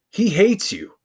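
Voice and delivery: emphatic repeat. Real, not acted. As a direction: hammer the warning home the second time. Repeats warning with contrast.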